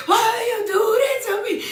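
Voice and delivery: high, rushed mock wail; boo-hoo crying. Hurt woman to cheating partner, boo-hoo crying. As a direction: imitate wailing through tears, pitched high. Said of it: "I'm just boo-hoo crying".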